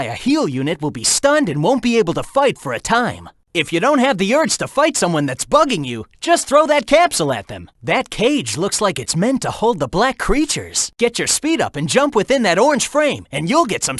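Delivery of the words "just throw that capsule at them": ordinary delivery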